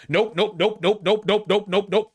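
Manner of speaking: high-pitched string of nopes cutting in; emphatic refusal. Acted. Commentator cutting off an imagined socialist candidate, emphatic refusal.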